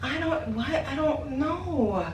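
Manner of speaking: high, slow, lost-for-words disbelief; baffled. Real, not acted. Still stunned by the stranger at her door, high slow disbelief. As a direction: trail off, truly baffled.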